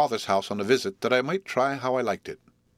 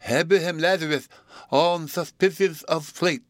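excitable suitor; 9 semitones higher, 5 dB louder than usual. Acted.

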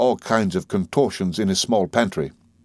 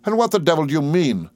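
higher-pitched, affronted demand; mildly outraged gentleman; indignant retort; 7 semitones higher than usual. A narrator's reading. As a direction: round on the waiter indignantly. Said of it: "retorted Steerforth".

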